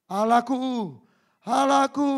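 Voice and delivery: crying out high and loud in anguish. Acted. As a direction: scream it up to heaven. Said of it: "Dia teriak". (berseru keras penuh derita)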